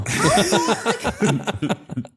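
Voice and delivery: a high laugh that tips over; high burst of laughter. Real, not acted. Guest cracking up at the host's teasing, real high laugh. Host teases him.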